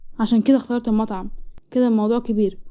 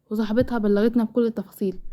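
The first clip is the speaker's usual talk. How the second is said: usual speaking voice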